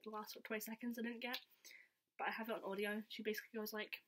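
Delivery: ordinary delivery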